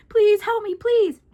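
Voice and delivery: high, loud desperate plea; frantic plea. Acted. Narrator pounds on the neighbors' door, begging frantically. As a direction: beg for help in terror. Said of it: "banged on it frantically".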